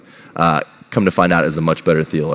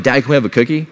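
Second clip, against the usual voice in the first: put-on higher-pitched kid asking for a treat; lightly acted child's request; pleading kids; 8 semitones higher, 3 dB louder, faster than usual. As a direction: imitate a child pestering dad. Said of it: "So the kids were like".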